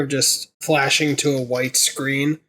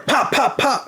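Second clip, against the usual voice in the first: high, popping mock gunfire; imitated gunshots; 7 semitones higher than usual. Acting out a gunman firing outside a theater, imitated gunshots. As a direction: pop out three mock shots. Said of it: "someone with a gun".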